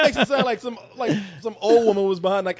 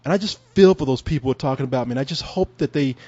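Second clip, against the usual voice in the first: playing the victim; 9 semitones lower than usual. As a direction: sound humble and wounded. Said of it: "I would play the victim".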